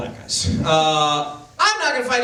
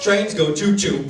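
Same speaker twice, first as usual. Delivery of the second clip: feigned simpleton. Retold or imitated.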